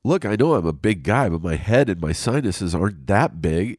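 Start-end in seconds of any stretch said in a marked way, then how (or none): none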